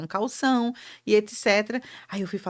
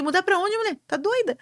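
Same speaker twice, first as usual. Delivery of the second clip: retold husband's shocked reply; incredulous husband; 10 semitones higher than usual. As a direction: play the husband who can't believe it.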